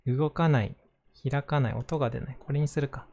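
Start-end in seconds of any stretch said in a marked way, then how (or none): none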